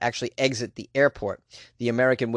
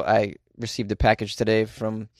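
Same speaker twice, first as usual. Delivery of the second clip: ordinary talk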